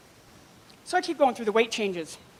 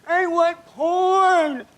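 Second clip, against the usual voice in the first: very slow, higher and louder whine; imitating brother; 7 semitones higher, 6 dB louder, much slower than usual. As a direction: drag it out like a stubborn kid. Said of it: "I said".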